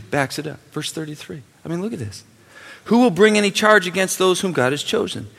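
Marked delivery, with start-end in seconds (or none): 2.8-5.3 scripture read with rising fervor. Acted. Reads verse rising into preaching.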